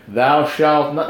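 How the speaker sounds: slow, mock-biblical proclamation; stately, drawn-out commandment; King James. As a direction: draw out each word like old scripture. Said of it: "That's King James who talked like that".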